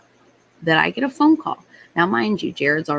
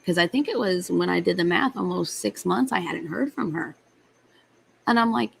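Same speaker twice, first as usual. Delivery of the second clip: normal talking voice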